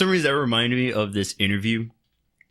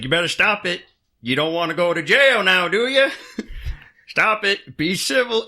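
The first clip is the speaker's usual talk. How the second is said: high, loud mock gentle scolding; polite Canadian cop; 10 semitones higher, 4 dB louder than usual. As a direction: chide them like a sweet cop. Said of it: "And then it's like".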